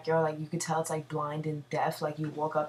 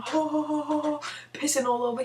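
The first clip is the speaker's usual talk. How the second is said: high mock "ho ho" laugh; hammed-up high drunken chuckle; imitating his laugh; 10 semitones higher than usual. Drunk guy laughing while he pisses, high mock laugh. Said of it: "he's laughing".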